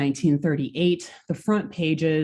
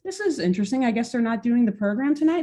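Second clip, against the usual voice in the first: casual listener voice; 6 semitones higher, faster than usual. Retold or imitated.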